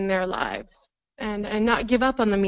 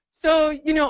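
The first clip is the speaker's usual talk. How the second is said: ordinary talk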